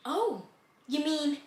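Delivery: soft, faint mock tears; acted weepy hush; imitated tearful. Crying girl breaking the news, tearfully hushed. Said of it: "Full tears crying".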